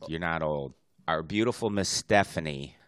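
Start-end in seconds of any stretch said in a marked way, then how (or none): 0.1-0.7 softer repeat. Real, not acted. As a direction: drop to a soft, lower reassurance. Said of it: "I'm trying to stick up for you".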